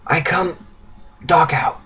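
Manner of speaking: soft, weak, broken speech; exhausted, halting young refugee; faltering from exhaustion. A narrator's reading. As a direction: push each word out with long pauses, struggling for strength. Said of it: "She falters from exhaustion".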